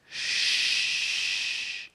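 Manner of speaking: whispered hush from the crowd; shushing. Acted. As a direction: hiss a sharp shh. Crowd hushing the father.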